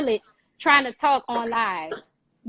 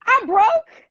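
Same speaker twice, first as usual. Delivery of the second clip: 1 crying out; 10 semitones higher, 6 dB louder than usual. Retold or imitated. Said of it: "go to my creator and say".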